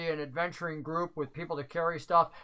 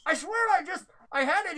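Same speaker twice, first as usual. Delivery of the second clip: much higher, flustered voice; fumbling, sure he had it; flustered NPC; 10 semitones higher than usual. Acted. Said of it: "He goes".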